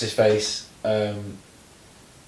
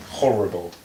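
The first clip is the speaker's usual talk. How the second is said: usual speaking voice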